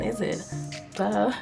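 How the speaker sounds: usual speaking voice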